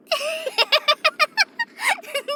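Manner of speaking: high-pitched burst of giggles; giggling. Acted. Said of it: "I don't mean to laugh".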